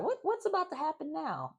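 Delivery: high, amazed question; shocked excitement. Real, not acted. Vlogger wondering about the cliffhanger, high amazed question. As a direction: ask it breathless with amazement.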